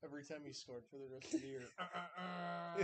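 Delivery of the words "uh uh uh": slow grunting chant. Retold or imitated. Imitating player's celebration sound.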